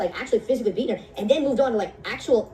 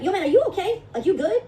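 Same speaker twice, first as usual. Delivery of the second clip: concerned; 9 semitones higher than usual. Retold or imitated. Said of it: "I was like".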